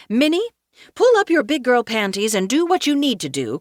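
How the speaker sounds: high, loud voice of a pushy optimist; rally her with loud, high insistence; bracing pep talk. A narrator's reading. Perpetual optimist urging Minnie to get going, bracing pep talk.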